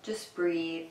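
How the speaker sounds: even, calm cues with long silences; unhurried meditation guide, pausing after each instruction; guided meditation. Acted. Guides breathing and relaxing.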